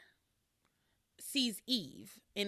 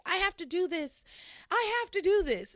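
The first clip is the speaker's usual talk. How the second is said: raised, insistent mock shout, higher on the repeat; retold yell; 9 semitones higher, 4 dB louder than usual. Upset Pilar insisting to everyone she must tell, mock yell. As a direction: push it out louder the second time. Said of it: "She's, like, yelling".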